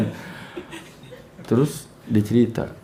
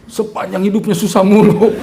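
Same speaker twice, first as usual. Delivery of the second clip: high loud laughing line; amused joking tone; laughing punchline; 9 semitones higher, 8 dB louder than usual. Real, not acted. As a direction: deliver the joke through laughter.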